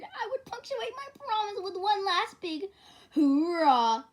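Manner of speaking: loud, high build to a bellow. A narrator's reading. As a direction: swell into a big hoorah. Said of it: "I bellowed out the loudest".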